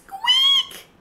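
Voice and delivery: very high, loud squeak; enormous squeak. A narrator's reading. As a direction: let out a huge frightened squeak. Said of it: "let out an enormous".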